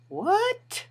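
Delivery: very high, rising disbelief; incredulous. Retold or imitated. Storyteller reacting to a racial insult, incredulous. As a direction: squeak it out as a stunned question.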